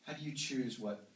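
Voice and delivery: ordinary talk, nothing marked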